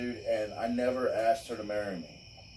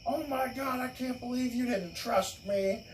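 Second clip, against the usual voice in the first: much higher, wounded and whiny; mock hurt girlfriend; 5 semitones higher than usual. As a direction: ham up her betrayed outburst. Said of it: "she was like".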